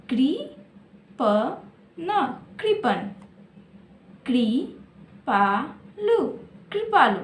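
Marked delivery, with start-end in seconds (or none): 0.1-1.6 coupon stretched slowly, part by part; slowed to syllables. Shown as a demonstration. Teacher sounding out a word for pupils, slowly. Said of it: "तीन अक्षर में क्या है?". 4.3-6.2 कृपालु drawn out slowly, piece by piece; slowed to syllables. Shown as a demonstration. Teacher breaking a word into syllables for children, slowly. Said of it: "कैसे पढ़ेंगे?".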